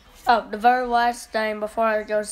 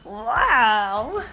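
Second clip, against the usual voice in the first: ordinary talk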